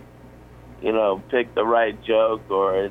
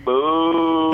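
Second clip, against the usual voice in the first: long drawn-out boo, pitched up; imitated booing crowd; 6 semitones higher, 3 dB louder than usual. Audience turning on the young comic, imitated booing. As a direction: hold out a mock crowd boo. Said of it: "Thirty-five hundred people in unison".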